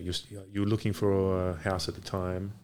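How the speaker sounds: usual speaking voice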